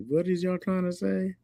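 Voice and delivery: high-pitched baffled question; exaggerated high puzzlement; mock bewilderment. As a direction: squeak it in comic confusion. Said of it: "I be like".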